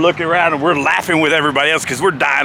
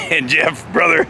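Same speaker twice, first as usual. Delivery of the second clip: ordinary delivery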